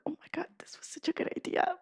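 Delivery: quick excited whisper to herself; hushed awe at her own idea; whispered thought. Retold or imitated. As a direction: breathe it out like a delighted secret. Inner thought after giving gift.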